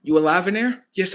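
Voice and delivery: usual speaking voice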